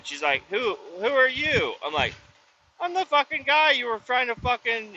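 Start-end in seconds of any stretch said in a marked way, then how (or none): 0.5-1.7 high, puzzled mock guard voice, a touch put-on; imitating the security guard. Retelling the guard's reply to the worker, mock puzzled. 2.8-5.0 higher, heated mock confrontation; retold angry outburst. Worker retelling how he confronted the guard, higher and heated.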